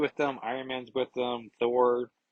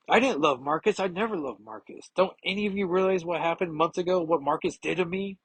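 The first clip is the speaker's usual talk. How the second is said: higher, pained insistence; distraught protest; 7 semitones higher than usual. Acted. Carol confronts the whole team, higher pained indignant protest. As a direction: plead with them to finally understand.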